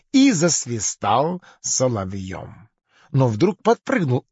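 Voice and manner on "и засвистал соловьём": plain reading voice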